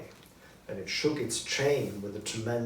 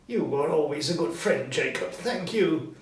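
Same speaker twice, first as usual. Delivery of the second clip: higher, a touch louder thanks; grateful Scrooge; 5 semitones higher, 6 dB louder than usual. Acted. Scrooge thanking Marley, higher and eager. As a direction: thank your old friend.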